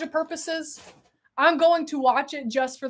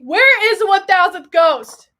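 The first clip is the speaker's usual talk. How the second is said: excited; 4 semitones higher, 10 dB louder than usual. Real, not acted. Looking for a gift.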